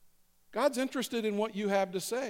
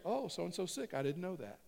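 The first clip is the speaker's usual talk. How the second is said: hushed, lightly voiced God jotting down news; mock note-taking God; 8 dB quieter than usual. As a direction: play a mildly surprised note-taker under your breath. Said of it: "he's taking notes as we say our prayers".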